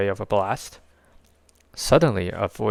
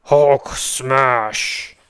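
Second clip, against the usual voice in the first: louder monster roar; Hulk voice; 4 semitones higher, 6 dB louder than usual. A narrator's reading. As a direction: smash it out like a brute.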